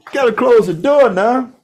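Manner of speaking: loud call across the room. Real, not acted. Calling out to shut door.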